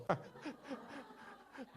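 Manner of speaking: high, soft burst of laughter; laughing at his own crying. Real, not acted. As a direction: laugh at yourself helplessly.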